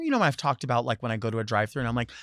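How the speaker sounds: ordinary talk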